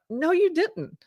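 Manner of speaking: high-pitched imitation of a wife in denial; retold disbelief. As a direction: mimic her refusal, pitched well up. Retold wife's shocked denial of confession.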